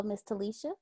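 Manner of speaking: usual speaking voice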